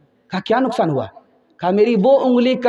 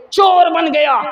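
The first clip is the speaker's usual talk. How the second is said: چور cried out high and loud; condemned son shouting at the crowd; anguished shout; 9 semitones higher, 4 dB louder than usual. Acted. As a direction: shout it like a confession torn out of him. Son cries out his guilt.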